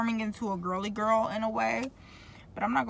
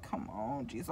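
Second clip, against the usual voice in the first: quiet exasperated mutter; 9 semitones lower, 11 dB quieter, slower than usual. Real, not acted. Frustrated aside about braces pain.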